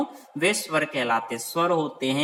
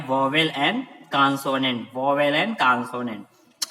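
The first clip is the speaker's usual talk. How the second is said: slow, spelled-out repeat; much slower than usual. Shown as a demonstration. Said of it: "teacher repeats English terms slowly".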